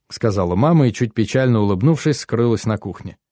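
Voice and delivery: ordinary delivery, nothing marked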